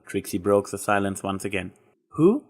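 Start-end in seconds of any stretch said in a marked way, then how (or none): 2.1-2.4 surprised. A narrator's reading. Surprised question.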